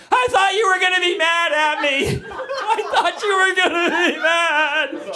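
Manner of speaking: high, drawn-out imitation wail; hammed-up tearful kid voice; mock child sobbing. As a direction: wail like a sobbing little girl. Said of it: "She's sobbing".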